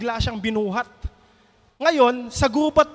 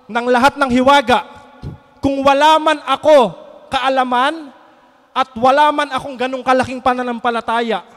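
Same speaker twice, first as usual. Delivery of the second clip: preaching at full force; 6 dB louder than usual. Acted. Preacher proclaims the reversed verse to the congregation, loud and fervent. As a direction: preach it out, rising to the climax.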